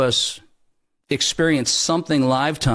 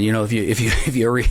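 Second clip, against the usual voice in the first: usual speaking voice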